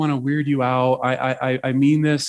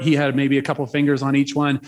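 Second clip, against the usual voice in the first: usual speaking voice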